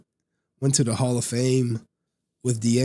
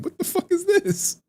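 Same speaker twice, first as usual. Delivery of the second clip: much higher, stunned what-the-fuck; retold outraged disbelief; shocked disbelief. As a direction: squeak it out in outrage. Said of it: "I was like".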